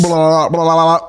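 low mock caveman blah; caveman gibberish. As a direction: babble like a caveman. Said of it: "that's thank you in a caveman language".

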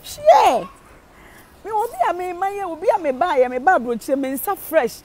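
lightly voiced high mimicry of a friend; a hint of a bragging friend abroad; imitating sister Agyae. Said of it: "no deɛ sister Agyae ka o".

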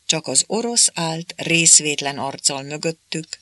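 usual speaking voice

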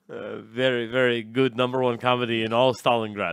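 put-on Russian accent, broken English. Producer praising the comedy of all Stalingrad, Russian accent. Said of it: "but Russian".

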